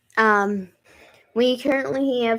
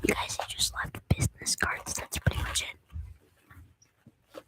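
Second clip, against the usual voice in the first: hushed unvoiced whisper; soft whisper, slowing at the end; 11 dB quieter than usual. Real, not acted. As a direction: lean in and whisper it. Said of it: "whispering beside the recording".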